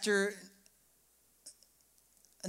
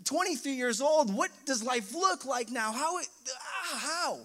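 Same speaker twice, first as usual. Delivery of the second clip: raised, higher outburst of hurt questions; distraught inner outcry; 5 semitones higher, 3 dB louder than usual. Retold or imitated. Son recalling his parents' divorce news, distraught outcry. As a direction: let the hurt and confusion pour out. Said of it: "All these emotions of".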